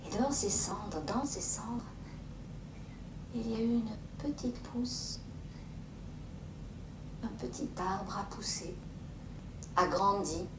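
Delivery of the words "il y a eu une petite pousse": hushed whisper; gentle wonder whisper; soft wonder. A narrator's reading. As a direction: whisper it with awe. Tender wonder of new growth.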